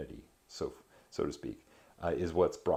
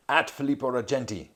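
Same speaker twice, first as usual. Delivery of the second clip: loud crowd shout, pitched up; 8 semitones higher, 9 dB louder than usual. A narrator's reading. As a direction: call it out like a jeering mob. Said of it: "All shouted".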